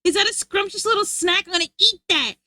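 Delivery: greedy mother scorpion eyeing a snack; hungry scorpion mom. Retold or imitated. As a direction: coo over a tasty snack. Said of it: "the mom was like".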